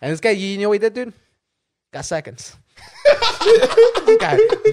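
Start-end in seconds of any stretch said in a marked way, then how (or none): 3.4-4.7 high laughing burst into the words. Real, not acted. Mocking the friend who took seconds, laughing loudly.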